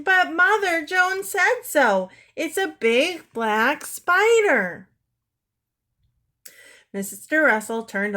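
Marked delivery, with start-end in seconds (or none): 0.0-4.9 high, loud, slow squealing plea; childish squeal, insisting. A narrator's reading. Anne squeals to her mother, high and loud.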